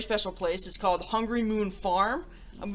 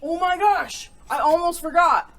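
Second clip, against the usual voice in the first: high, loud oh my gosh; startled realization; sudden remembering; 8 semitones higher, 5 dB louder than usual. Real, not acted. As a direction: catch yourself mid-goodbye.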